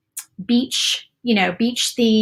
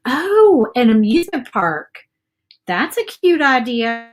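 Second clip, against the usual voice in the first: pleasantly surprised; 2 semitones higher than usual. Real, not acted. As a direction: react with real surprise to a new idea. Delighted reaction to a viewer's suggestion.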